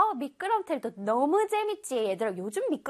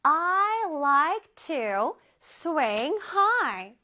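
slow example read; 3 semitones higher, much slower than usual. Shown as a demonstration. Teacher reading example to children, very slow. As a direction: spell out the sentence slowly for kids. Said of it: "예문에서 볼게요".

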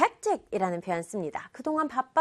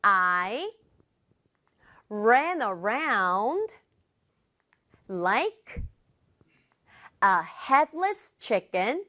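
slow model reading, chunk by chunk; slow repeat-after-me; much slower than usual. Shown as a demonstration. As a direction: break it into small pieces with long pauses for learners. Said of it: "표현 함께 말해볼게요". (한 덩어리씩 끊어서 천천히)

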